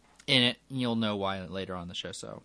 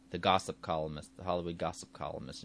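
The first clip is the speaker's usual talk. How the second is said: usual speaking voice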